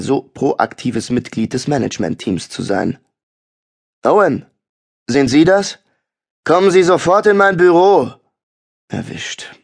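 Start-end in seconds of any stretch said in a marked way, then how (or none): 4.0-8.1 loud, higher pompous summons; overeager bossy call. A narrator's reading. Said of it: "klang so übereifrig und aufgeblasen". 8.9-9.6 soft unvoiced aside; whispered aside. A narrator's reading. Narrator caught, aside.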